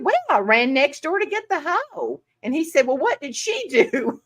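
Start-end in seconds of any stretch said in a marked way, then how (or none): 0.0-2.2 higher sweet Southern lady reply; Southern Belle teacher. Retold or imitated. Teacher answering the boy in a higher Southern lilt. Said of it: "little old Southern Belle". 2.9-4.2 laughing through the punchline. Retold or imitated. Voicing the boy, laughing.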